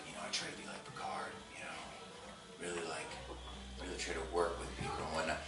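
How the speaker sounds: very soft, low, measured murmur; near-whispered, self-serious brother; hushed diplomat. Retold or imitated. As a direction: speak low and gently, like a thoughtful captain. Acts out the calm Picard-like brother.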